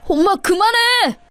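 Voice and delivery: put-on high, slow child's shout; a child begging mom to stop. Child shouts at his mother to stop the beating, high and slow.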